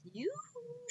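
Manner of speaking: very high sing-song call; calling yoo-hoo. Acted. Witch Gritch calling across the meadow, high sing-song. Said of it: "she shouted".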